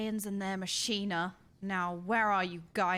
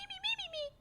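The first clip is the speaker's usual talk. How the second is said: high, soft whimpering me's; mock wailing; 7 dB quieter, faster than usual. Real, not acted. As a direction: whine it out, heartbroken. Wailing again.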